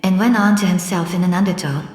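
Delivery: usual speaking voice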